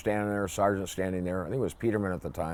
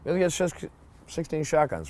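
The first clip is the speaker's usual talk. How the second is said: much higher, impatient barking repeat; exasperated repeat; 7 semitones higher than usual. Retold or imitated. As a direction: say it again like he can't believe you asked. Sergeant repeats the order, exasperated.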